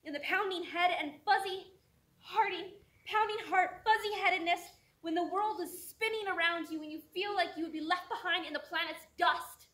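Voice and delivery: loud, high, pounding delivery; desperate, full-voiced poetic climax; overwhelmed outburst. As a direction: shout it as the world spins away. Spoken-word poem peaks in overwhelm.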